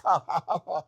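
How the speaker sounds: high put-on snooty laugh; mock pretentious laugh. Waiter laughing at the diner, high mock-snooty laugh. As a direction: laugh haughtily in a high fake voice.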